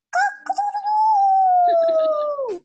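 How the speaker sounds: very high, loud, long crow; rooster crow. Pretend. Pupil asked for the rooster sound, loud crowing. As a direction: crow and hold the last note, then drop. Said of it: "please make the rooster sound".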